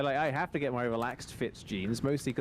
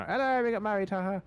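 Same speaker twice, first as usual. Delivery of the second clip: higher, playful mock-cheery announcement; 8 semitones higher, faster than usual. Couple announcing their wedding to family by video, cheery. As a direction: announce it brightly with a little haha.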